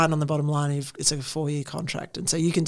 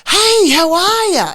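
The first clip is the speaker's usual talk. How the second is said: high, loud, slowed-down cheery hello; mock a gushing American saying hi; imitating American greeting; 12 dB louder, slower than usual. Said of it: "So they'd be like".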